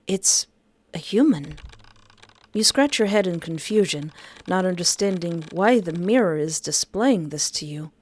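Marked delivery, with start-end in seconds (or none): none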